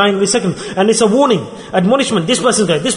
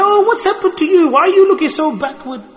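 much higher, pleading woman's voice; voicing the modest woman; 10 semitones higher than usual. Preacher voicing a modest woman scolding the careless ones, high and pleading. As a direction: plead like a worried sister. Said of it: "she used to say".

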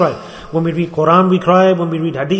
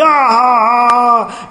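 drawn-out, higher-pitched mock guffaw; imitated loud laugh; 5 semitones higher, 3 dB louder than usual. Preacher mocking people who laugh openly in the masjid, loud mock laugh. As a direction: mimic a big open laugh on one long "ah". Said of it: "we just laugh loudly".

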